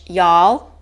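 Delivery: y'all in an American accent, rising. Shown as a demonstration. Teacher showing American English to learners, American accent. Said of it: "it's more in that accent".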